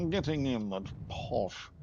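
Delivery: slow, put-on posh English accent. Shown as a demonstration. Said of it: "in a posh accent".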